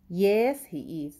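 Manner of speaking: slow, deliberate answer for learners; slow model reading. Shown as a demonstration. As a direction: say it slowly so the class can follow. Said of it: "teacher reads model answer slowly". (آهسته و شمرده)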